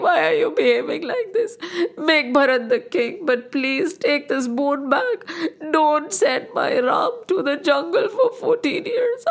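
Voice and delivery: high, tearful begging king; a father sobbing for his son; weeping plea. Acted. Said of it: "Raja Dashrath started weeping and crying".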